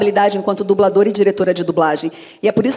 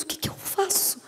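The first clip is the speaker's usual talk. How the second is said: hushed, breath-only plea; helpless plea; 8 semitones higher, 15 dB quieter than usual. Retold or imitated. Dubber asking director for help, retold in a helpless whisper. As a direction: drop to a whisper, at a loss.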